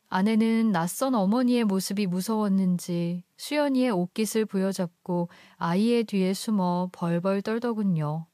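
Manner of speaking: plain reading voice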